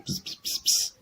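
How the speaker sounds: breathy whispered buzzing like a bee; soft bee buzz. Acted. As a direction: hum it quietly like a bee. Bee refrain in a poem.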